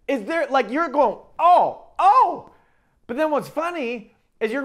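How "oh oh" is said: high, loud double oh of alarm; shocked oh. Retold or imitated. Parent reacting to the child's word, high loud alarmed oh. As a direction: react as if caught off guard.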